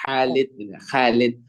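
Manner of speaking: Khalid with light open kha; thin Arabic Khalid. Retold or imitated.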